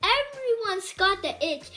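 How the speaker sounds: plain reading voice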